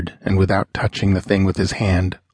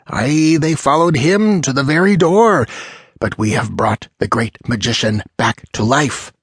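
exultant; 3 semitones higher than usual. Acted. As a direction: gloat to the doubters, carried away. Sorcerer exults over his success.

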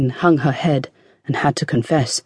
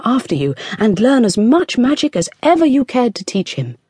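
much higher, soothing her husband anxiously; pleading queen; 7 semitones higher than usual. A narrator's reading. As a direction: coax the angry king gently. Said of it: "to her husband she said".

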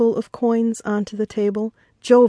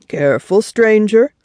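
kindly warning; 3 semitones higher, 5 dB louder than usual. A narrator's reading. Fisherman warns the newcomer about sharks, kindly caution. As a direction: friendly caution in a fisherman's voice, lifting at the end. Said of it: "kindly offered the other man".

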